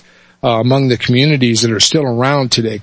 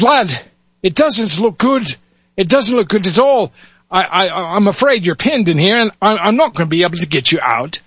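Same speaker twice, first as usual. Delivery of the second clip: Irish cop; 6 semitones higher than usual. Acted. Irish officer Muldoon tells the trapped lad, grave Irish accent. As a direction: break bad news in an Irish lilt. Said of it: "switch between dialects here".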